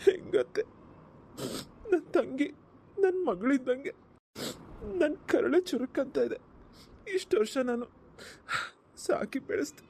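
grief-stricken brother, broken phrases with long pauses; tearful, halting lament; crying. Acted. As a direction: choke out each phrase through tears. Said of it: "ಅಳ್ಬೇಡಿ, ಸಮಾಧಾನ ಮಾಡ್ಕೊಳ್ಳಿ".

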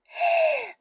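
short high teasing laugh; a quick amused giggle at his blush. Acted. Older sister giggles at the boy's shyness, teasing laugh.

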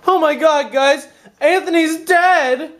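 high, hammed-up alarm; mock panic. Pretending to panic that Anthony is dead.

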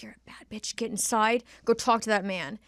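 whispered pep talk to herself; 1 hushed self pep talk. Retold or imitated. Hyping herself up before going inside, in a whisper. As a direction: hush it like a secret hype-up.

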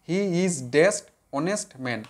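"he is dash honest man" very slow, word by word; slow it right down so they can write; slow dictation. Shown as a demonstration. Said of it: "আবার দেখো". ("he is dash honest man" খুব ধীরে, শব্দে শব্দে)

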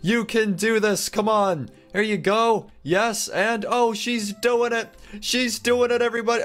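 cheering her on. Real, not acted. Cheering her through the pencils.

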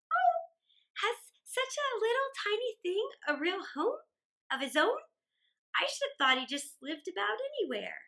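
put-on high, amazed child's gasp of wonder; wondering girl. A narrator's reading. Mary, amazed, asks her father about the ant's home. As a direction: marvel at it like a small girl.

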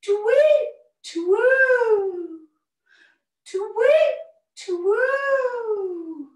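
high, loud hooting tweet-twoo; hammed-up owl hoots sung high and loud; owl call. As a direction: hoot it out like a big owl.

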